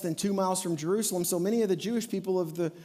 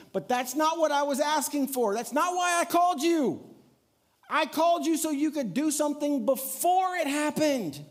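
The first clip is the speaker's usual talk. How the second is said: higher and louder, pressing hard; frustrated outburst; 7 semitones higher, 4 dB louder than usual. Retold or imitated. Martha tells Jesus this isn't why she called, exasperated and loud. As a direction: insist that's not why she called him.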